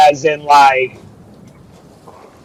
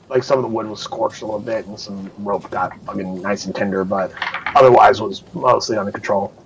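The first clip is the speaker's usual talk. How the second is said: understated aside; 6 semitones lower, 6 dB quieter than usual. Real, not acted. GM downplaying damage.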